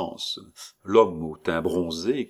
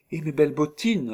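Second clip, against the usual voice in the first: put-on higher proud child; little girl; 9 semitones higher, 3 dB louder than usual. Little girl adds her boots, higher child voice. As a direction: pipe up proudly.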